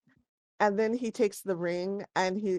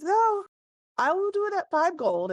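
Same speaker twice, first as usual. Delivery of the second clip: higher, louder shopkeeper giving in; shopkeeper caving in; 12 semitones higher, 5 dB louder than usual. Acted. Shopkeeper giving in on the price to the party, higher and louder. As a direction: blurt it out as you give up the haggle.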